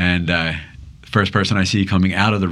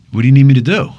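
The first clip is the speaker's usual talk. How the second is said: eager stranger; 6 semitones higher, 8 dB louder, faster than usual. Retold or imitated. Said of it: "And he said".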